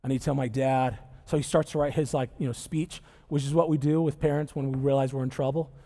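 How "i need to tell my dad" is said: low, much softer, defeated son talking to himself; broken realization. Acted. Lost son realizing he must go home, soft and low. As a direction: let it sink in, quiet and lower. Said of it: "and he's realizing".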